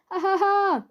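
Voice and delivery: high, loud crazed laughing; mad cackle. Acted. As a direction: cackle like he's lost it.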